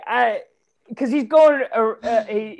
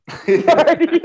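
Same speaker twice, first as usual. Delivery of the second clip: laughing; 11 semitones higher, 6 dB louder than usual. Real, not acted. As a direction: blurt it out high and loud, laughing. Echoing a funny movie quote.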